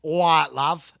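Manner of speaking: put-on Cockney greeting; Cockney accent. Showing chat how a Cockney talks, Cockney accent. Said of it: "he's a Cockney".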